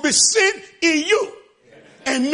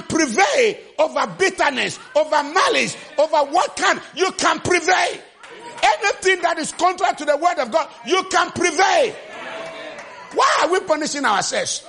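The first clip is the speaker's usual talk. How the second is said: raised, high preaching cry; preaching at full force; 4 semitones higher than usual. Acted. Preacher rousing the congregation, raised voice. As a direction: drive it home to the crowd.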